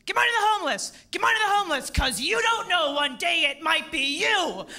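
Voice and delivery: loud, high yelling street shout; aggressive homeless guy yelling. Retold or imitated. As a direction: bellow it at passersby like the block's angry panhandler. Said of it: "And he goes".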